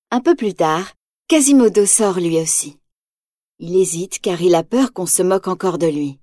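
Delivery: usual speaking voice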